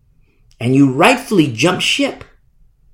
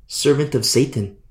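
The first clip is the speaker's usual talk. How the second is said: ordinary talk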